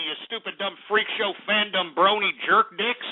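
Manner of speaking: ordinary talk, nothing marked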